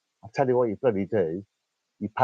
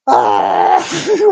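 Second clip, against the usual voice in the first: mock startled cry; 2 semitones higher, 12 dB louder than usual. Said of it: "whatever I sounded like".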